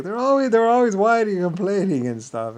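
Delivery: high put-on griping voice; mock whining general. Retelling the general griping about allies, high mock whine. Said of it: "He's like".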